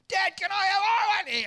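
put-on high, loud kids clamouring; demanding kids. Kids pestering their dad, high and loud. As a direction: whine like kids all wanting something.

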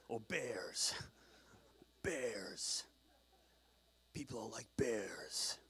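hushed, slow trailer-man growl of awe; movie trailer voice. Pretend. Preacher daydreams of being bears, mock trailer voice. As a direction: play at a soft, dramatic trailer narrator.

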